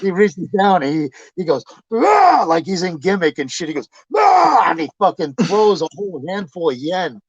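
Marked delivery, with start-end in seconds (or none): 1.9-2.4 high, loud gimmick yell; mock battle roar. Man throwing coins at the window, loud high yell. Imitated wrestler-style roar. 4.1-4.7 high, loud gimmick yell; mock battle roar. Man throwing coins at the window, loud high yell.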